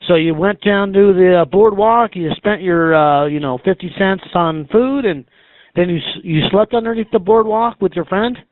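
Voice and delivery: ordinary talk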